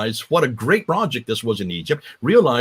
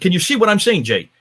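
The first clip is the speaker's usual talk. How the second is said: retold exclaim; 5 semitones higher, 4 dB louder than usual. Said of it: "And she said".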